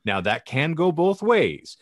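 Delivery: normal talking voice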